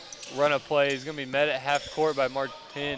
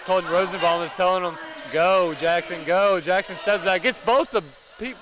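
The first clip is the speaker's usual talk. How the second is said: raised, driving live call; excited play-by-play; 3 semitones higher, 6 dB louder than usual. Real, not acted. Commentator calls Jackson's step-back, excitement mounting.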